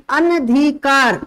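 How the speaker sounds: अनधिकार said slowly, short न with no आ; अनधिकार, clear and slow, no long आ after न; correct pronunciation. Shown as a demonstration. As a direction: spell out अनधिकार syllable by syllable, slow. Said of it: "शुद्ध शब्द होता है अनधिकार".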